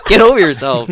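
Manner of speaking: loud mocking scoff. Mock scolding the gum ads.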